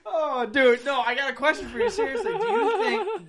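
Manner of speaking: high, slow, laughing near tears; cracking up mid-sentence; laughing hard. Real, not acted. Laughing fit.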